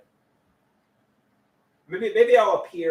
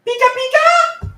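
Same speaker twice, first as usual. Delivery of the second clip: Pikachu voice; 9 dB louder than usual. Acted. Pikachu voice to pet.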